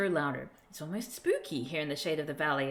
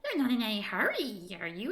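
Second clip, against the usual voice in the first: teasing tiny creature. Acted. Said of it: "it observes".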